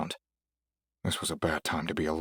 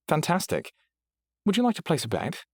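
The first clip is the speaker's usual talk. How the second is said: delighted British, much higher; eager British bookmaker; cheerful British AI; British accent; 11 semitones higher than usual. Acted. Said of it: "Is that why you chose a British accent?".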